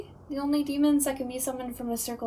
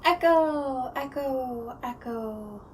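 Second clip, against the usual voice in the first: mock echo; 3 semitones lower, much slower than usual. Ghost playfully imitating an echo down the basement stairs, fading. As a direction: play your own echo, dying off into the distance.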